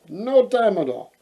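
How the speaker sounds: ordinary delivery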